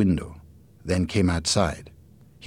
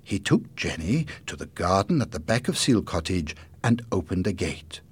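ordinary delivery, nothing marked

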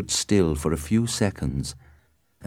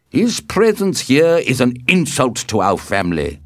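higher, louder protest; offended, raised voice; indignant; 6 semitones higher, 5 dB louder than usual. A narrator's reading. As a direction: protest hotly to an elder. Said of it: "Tybalt was furious".

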